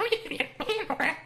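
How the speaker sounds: very high, soft garbled squeal; imitated rewind noise. Shown as a demonstration. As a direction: squeak like a tape running backwards. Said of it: "my imitation of, like, sounds where you reverse".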